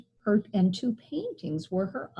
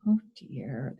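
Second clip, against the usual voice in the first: mild sadness; 7 semitones lower than usual. A narrator's reading. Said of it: "said sadly".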